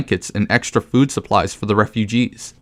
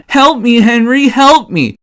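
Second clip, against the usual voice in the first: much higher, whiny begging, slowed down; mock damsel plea; 11 semitones higher, 4 dB louder than usual. Gamer mocking Johanka begging Henry for help, whiny falsetto. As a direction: whine it like a helpless damsel. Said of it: "going on about".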